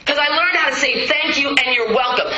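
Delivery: ordinary talk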